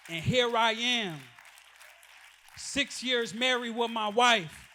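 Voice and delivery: ordinary talk, nothing marked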